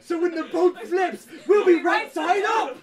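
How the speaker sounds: loud, slow, laughing triumph; excited. Acted. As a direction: announce it like genius. Excited bad idea.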